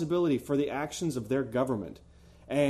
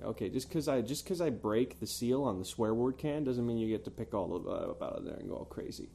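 softer, dropping to a whisper; hushed scolding; 4 semitones lower, 6 dB quieter than usual. Real, not acted. Host quietly scolding cohost Jake, hushed scolding. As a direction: rein him in under your breath.

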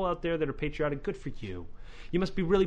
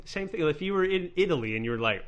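ordinary delivery

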